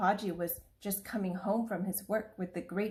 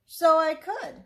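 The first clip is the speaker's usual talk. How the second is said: higher and louder, pleased tiger; delighted tiger; 8 semitones higher, 8 dB louder, slower than usual. Acted. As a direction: tiger pleased with the clever idea.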